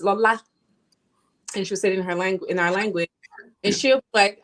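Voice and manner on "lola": name called with a rising Nigerian lilt; Nigerian accent. Retold or imitated. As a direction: say it like a Nigerian mom calling. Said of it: "She actually said it in Nigerian accent".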